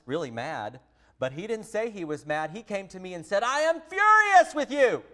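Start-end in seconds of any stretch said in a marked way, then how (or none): 3.4-5.0 high, loud imitation of an indignant seven-year-old; hammed-up furious kid voice; mock furious child. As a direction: play the son declaring his fury, much higher and louder. Mother voices son's angry words.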